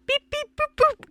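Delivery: very high phone-dialing beeps; imitated dial tones. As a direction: mimic the keypad tones in a squeaky pitch.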